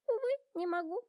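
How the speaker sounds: a high, firm no; Giraffe voice. A narrator's reading. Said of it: "повторила Жирафа".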